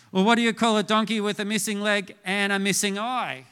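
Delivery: usual speaking voice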